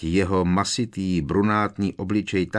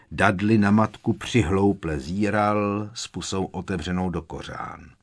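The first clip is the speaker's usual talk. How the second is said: ordinary delivery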